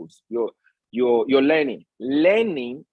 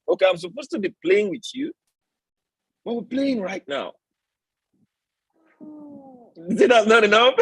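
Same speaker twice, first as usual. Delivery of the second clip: playful with his son; 9 semitones higher than usual. Real, not acted. Father to his interrupting little son, playful higher teasing.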